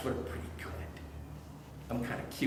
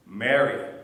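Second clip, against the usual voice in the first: louder, lower and falling, a knowing call; calling her name; 4 semitones lower, 6 dB louder than usual. Acted. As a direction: say her name so she finally knows you. Jesus calls, she finally recognizes.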